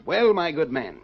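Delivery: a light put-on English accent; phony English gent. Townley fakes an English accent for a visitor. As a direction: play the polite English gentleman. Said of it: "Don't forget your accent".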